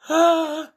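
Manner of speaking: loud, high dramatic gasp; sharp acted intake of breath; shocked gasp. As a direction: gasp in mock horror. Characters shocked at broken toy.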